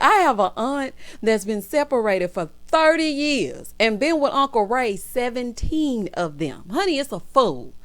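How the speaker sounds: ordinary delivery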